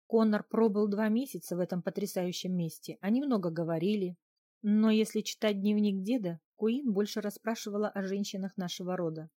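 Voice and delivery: plain reading voice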